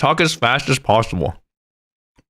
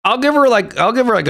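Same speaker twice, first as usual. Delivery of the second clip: southern accent; 10 semitones higher, faster than usual. Shown as a demonstration. Voicing the girl with a southern accent. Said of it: "a southern accent".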